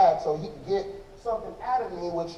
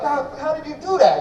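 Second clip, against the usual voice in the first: amazed; 9 semitones higher, 6 dB louder than usual. Retold or imitated. Amazed at drummer's soft hands.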